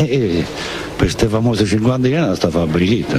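usual speaking voice

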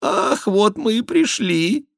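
high, slow, loud whimper; put-on tearful whine. A narrator's reading. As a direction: wail it as a mock penitent. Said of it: "слезливым тоном отозвался Шико".

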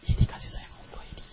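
breathy hushed whisper. Real, not acted. Preacher whispering to the congregation about God's love, hushed. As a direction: lean in and whisper it.

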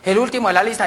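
usual speaking voice

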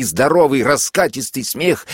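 plain reading voice

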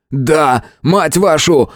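high angry outburst; explode, voice climbing; losing it, shouting. A narrator's reading. Hero snaps at the goddess, shouting. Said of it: "вновь сорвался и закричал".

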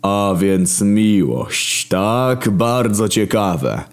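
God drawling each word, very slow; slow menacing murmur. Acted. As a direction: Creator muses darkly over love. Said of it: "mruknął".

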